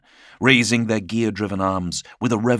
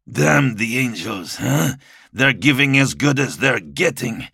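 breathless harsh warrior; 7 semitones higher, 4 dB louder than usual. Acted. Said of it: "Breathless from the fighting, his voice was harsher".

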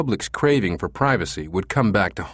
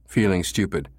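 ordinary delivery

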